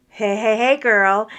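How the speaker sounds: loud friendly call across the lot. Retold or imitated. Young man calling out to her, loud and friendly. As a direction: call out to get her attention.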